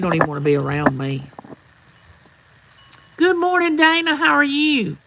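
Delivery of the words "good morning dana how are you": high sing-song greeting. Real, not acted. Streamer welcoming Dana who just joined, high sing-song greeting. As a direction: call out a greeting in a high lilt. Calling a greeting to someone arriving.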